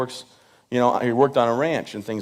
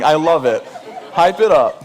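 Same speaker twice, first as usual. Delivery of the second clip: laughing; 2 semitones higher, 4 dB louder than usual. Real, not acted. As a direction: egg the crowd on through a laugh. Crowd cheers, he eggs them on.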